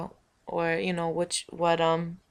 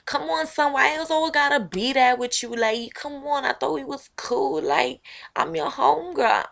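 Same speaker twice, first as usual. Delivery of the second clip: higher, louder whiny plea; pleading former homegirl; 9 semitones higher, 8 dB louder than usual. Acted. The old homegirl pleading that they're still cool, higher and whiny. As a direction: beg to still be friends.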